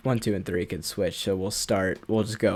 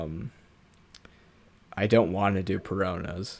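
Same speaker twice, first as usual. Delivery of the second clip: ordinary talk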